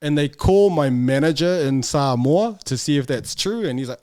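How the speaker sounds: ordinary talk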